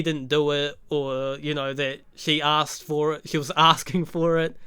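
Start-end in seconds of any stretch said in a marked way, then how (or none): none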